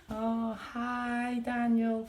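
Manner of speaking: high, sing-song, fake friendly hello; mock sweet neighbour greeting. Mimicking the mother waving from her balcony, sugary and high. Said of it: "now she's all like".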